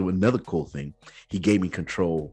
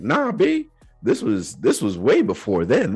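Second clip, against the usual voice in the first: high, loud, playful protest; emphatic denial; 8 semitones higher, 7 dB louder than usual. Retold or imitated. Answering friends who assumed a proposal, emphatic denial. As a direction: swoop the pitch up and brush the idea off.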